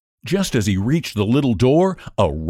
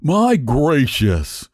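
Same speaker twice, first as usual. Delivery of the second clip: very slow, drawn-out exclamation; a stout creature short of breath; 3 semitones higher, much slower than usual. A narrator's reading. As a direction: puff out the words, worn out. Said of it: "was terribly out of breath".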